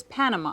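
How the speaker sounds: "Panama" said PAN-a-ma, stress on the first syllable; English pronunciation. Shown as a demonstration. Student saying the country's name in English, stress on PAN. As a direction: hit the flat English "Pan" at the front of "Panama". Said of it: "In English".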